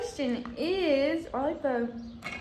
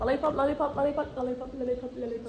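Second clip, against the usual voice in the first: said fast; faster than usual. Shown as a demonstration. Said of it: "Say it three times fast".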